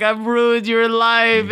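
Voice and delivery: high, drawn-out mock wail; imitating a fiancée's tearful despair; mock crying. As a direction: wail it like a hammed-up drunk apology.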